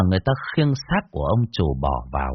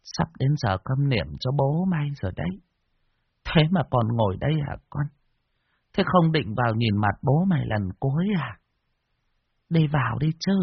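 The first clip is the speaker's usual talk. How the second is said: put-on higher, softer old woman's murmur; old woman at the ear; 4 semitones higher, 4 dB quieter than usual. Old woman murmurs into the son's ear, soft and higher. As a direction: lean in and murmur like a frail granny. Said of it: "Bà cụ ghé tai".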